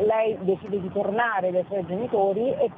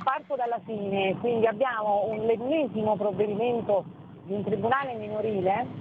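usual speaking voice

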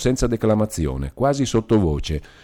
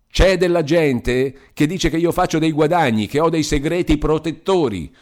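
high, strained yell; a shout that breaks under strain; torn, cracked shout; 7 semitones higher, 4 dB louder than usual. A narrator's reading. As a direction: voice ripped with indignation. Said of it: "gridò con una voce falsa e lacerata".

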